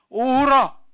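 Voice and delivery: a loud, high wail of torment; anguished cry. Acted. Preacher voices Paul lamenting his wretched state, anguished cry. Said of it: "막 괴로워하는 거예요".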